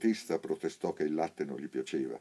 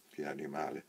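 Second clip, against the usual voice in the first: softer, muttered under the breath; indignant mutter; 7 dB quieter than usual. A narrator's reading. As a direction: grumble it in disgust. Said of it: "borbottò indignato il commesso".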